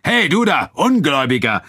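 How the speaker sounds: loud, high hail; single someone out across a crowd; Abu Dun calling out. A narrator's reading. Nubian fighter hails his friend from the stage, calling out. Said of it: "rief Abu Dun".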